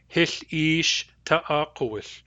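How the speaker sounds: Hith ish ta'akowith, the vowel of ish held long; Nuu-chah-nulth phrase. Shown as a demonstration. Teacher voicing Grace saying Hith ish ta'akowith with a long ish. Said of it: "the vowel of ish is long".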